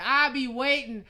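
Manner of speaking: ordinary talk, nothing marked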